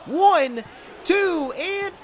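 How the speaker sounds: ordinary talk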